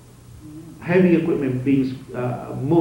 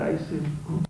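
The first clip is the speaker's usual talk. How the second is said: normal talking voice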